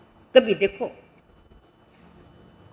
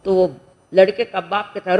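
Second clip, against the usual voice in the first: ordinary talk, nothing marked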